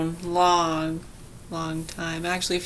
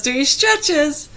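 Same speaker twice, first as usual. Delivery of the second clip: slow, higher mock old-man voice; old man's cheery quote; 9 semitones higher, 4 dB louder, slower than usual. Quoting the passing old man, slow and higher. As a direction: imitate him drawing it out, pleased. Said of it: "and he goes".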